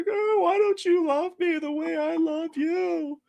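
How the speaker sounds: very high, slow mock wail; exaggerated crying whine; imitated wailing cry. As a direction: wail it long and keening, hammed up. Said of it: "making those wailing, crying sounds".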